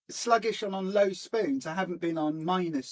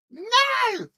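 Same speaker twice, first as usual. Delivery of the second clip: sudden high yelp of alarm; surprised cry; 7 dB louder than usual. Real, not acted. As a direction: yelp as something drops. Minifig suddenly falls on floor.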